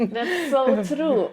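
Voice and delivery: laughing out the words; amused, laughing agreement. Real, not acted.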